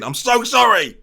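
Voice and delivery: loud, high mock-desperate cry; mock anguished apology. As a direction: blurt an over-dramatic apology. Apologising for his bad impression.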